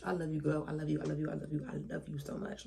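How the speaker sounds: normal talking voice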